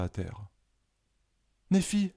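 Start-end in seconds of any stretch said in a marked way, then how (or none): 1.7-2.1 much higher, louder panicked call of a name; panicked cry. A narrator's reading. Colline calls after her vanished friend, panicked cry. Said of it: "s'écria Colline en panique".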